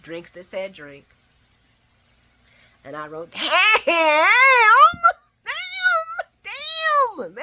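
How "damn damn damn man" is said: hammed-up crying howl, high and loud; anguished wail. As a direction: howl each word in mock agony. Acting out her anguished typed reaction.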